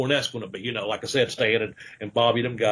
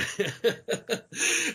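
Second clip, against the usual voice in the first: real high-pitched chuckle; laugh; 9 semitones higher, 5 dB quieter than usual. Real, not acted. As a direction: laugh at your own punchline, high and light. Laughing at his own punchline.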